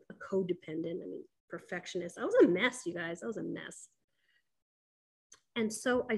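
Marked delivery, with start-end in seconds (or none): 2.2-2.9 louder, higher burst on "mess"; emphatic admission. Real, not acted. Confessing to viewers, emphatic. 3.2-3.9 quiet repeat. Real, not acted. Repeat, dropped and soft.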